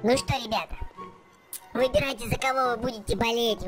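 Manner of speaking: normal talking voice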